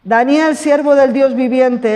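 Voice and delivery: normal talking voice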